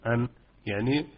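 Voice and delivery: ordinary delivery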